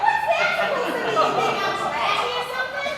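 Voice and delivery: high, loud indignant challenge; offended outburst. Acted. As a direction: fire back, loudly insulted. Takes offence in acted quarrel.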